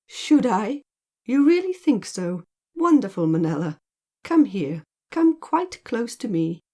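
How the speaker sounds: playful, pleased surprise, then beckoning; amused delight. A narrator's reading. Man delightedly calling woman closer, amused. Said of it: "He laughed, a deep, mellow, hearty laugh".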